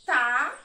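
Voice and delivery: "Tá" drawn out alone, loud; teacher loudly marking the syllable "Tá"; syllable demonstration. Shown as a demonstration. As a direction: sound out "Tá" as one long, separate syllable. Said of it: "Eu vou marcar a sílaba".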